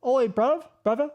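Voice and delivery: a cheeky Londoner calling to his mate; overdone British accent with a rising "bruv"; mock British accent. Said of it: "the Brits say brother".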